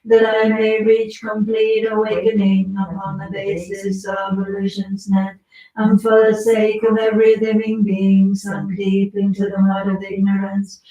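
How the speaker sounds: steady, sonorous liturgical reading; scripture recitation. Acted. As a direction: read the sacred text with devotion. Reads the Manjushri scripture aloud.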